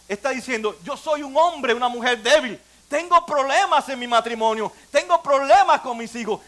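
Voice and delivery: normal talking voice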